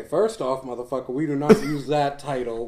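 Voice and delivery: usual speaking voice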